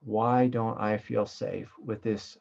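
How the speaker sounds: slow, searching Why don't I feel safe with this; slow self-question. Shown as a demonstration. Teacher models self-inquiry for the sangha. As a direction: let the question unfold slowly.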